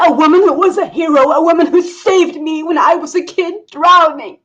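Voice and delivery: high, loud furious scolding; furious tirade. Acted. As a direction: berate the stupid villagers. Professor berates the villagers.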